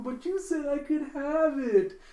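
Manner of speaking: high, loud, drawn-out whine; whining girl. Acted. Becky running off with the picture, whining protest. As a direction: wail it like a wronged child. Friend runs off with photo.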